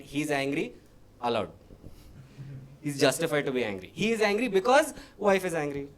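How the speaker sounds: usual speaking voice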